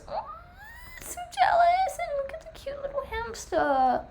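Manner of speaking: very high, squealy put-on cooing; mock-whiny squeak of envy and adoration; squeaky gushing. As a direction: squeal it in a tiny high voice, easing down at the end. Gushing over a cute hamster.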